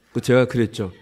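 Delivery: ordinary delivery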